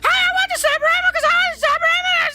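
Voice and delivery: fast, very high squeaky babble; mock squeaky-voiced pleading on repeat; absurdly high voice. Shown as a demonstration. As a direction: chatter at the top of your range. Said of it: "imagine for five seconds if my voice was".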